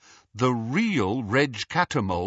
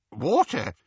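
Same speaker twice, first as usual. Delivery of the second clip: ordinary delivery, nothing marked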